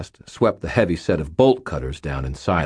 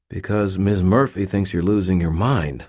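plain reading voice

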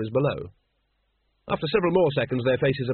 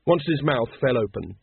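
plain reading voice

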